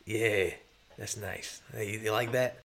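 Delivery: soft, low pet talk; tender coaxing. Acted. Player talking to the pterodactyl, soft and soothing. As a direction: murmur fondly to an animal.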